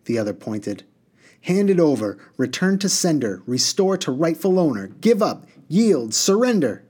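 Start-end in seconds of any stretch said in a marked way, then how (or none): 1.4-6.8 raised, emphatic string of orders; insistent demand. A narrator's reading. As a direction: fire off each demand in a high, pressing voice. Genie demanding his tool back.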